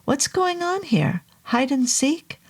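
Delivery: high, bemused questioning; puzzled surprise. Acted. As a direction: ask it baffled and amused.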